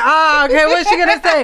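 high, loud taunting voice; mocking nosy critics. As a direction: mimic people waiting to pounce. Mock of waiting critics.

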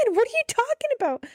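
high, quick, puzzled imitation of mom; baffled mom. Mom answering her dramatic daughter, baffled. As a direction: put on a bewildered mother's voice. Mom's baffled reply, acted.

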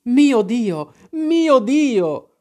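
high, loud wail of dismay; distressed cry. A narrator's reading. Mother finds the captain on the floor, high distressed cry. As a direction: cry out in alarm, pitch soaring. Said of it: "gridò mia madre".

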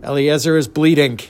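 ordinary delivery, nothing marked

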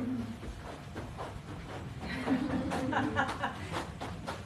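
silent wheezing laugh, high; high breathy laugh. Real, not acted. Pastor laughing at the congregation's shuffling runners, breathy laugh. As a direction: laugh helplessly, mostly air.

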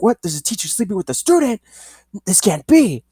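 high, fast, hammed-up cop disbelief; mock shocked cops. Cops jumping up hearing about the teacher, mock shocked. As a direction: play the cops gasping in fake shock. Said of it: "They're like".

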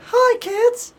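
very high squeaky cartoon greeting; Mickey Mouse impression. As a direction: chirp hello to the kids. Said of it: "awful Mickey Mouse impression".

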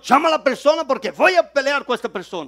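loud, high retelling of an angry objection; angry protest. Retold or imitated. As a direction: replay him shouting that he'll fight. Angry employee quoted in story. (protesta enojada, más fuerte y aguda)

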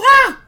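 very high, loud startled shriek; startled yelp. Retold or imitated. Worker startled by his name, high loud yelp. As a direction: shriek in sudden fright. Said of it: "Homer yells".

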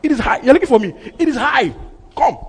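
loud, high commanding cry; bold challenge. Acted. Christ daring the men who came to arrest him, bold. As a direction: call them out fearlessly at full voice.

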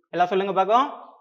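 normal talking voice

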